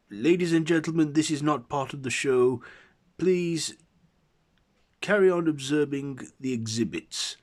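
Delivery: loud, high, hurried announcement to a crowd; flustered curator addressing visitors. A narrator's reading. Curator telling museum visitors it's not the show, loud announcement.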